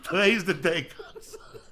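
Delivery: giggling pep talk to himself, last word breathed out; laughing quote. Pretend. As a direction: laugh it out, then drop to a breath. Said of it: "you tell that motherfucker".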